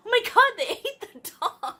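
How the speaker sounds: disbelieving gasp turning into laughter; shocked laugh. Real, not acted. Reacting to zombies eating the dog, shocked.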